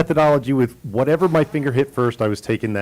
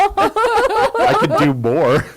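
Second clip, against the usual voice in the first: laughing squeal; 4 dB louder, much slower than usual. Pretend. Joking, laughing high drawl.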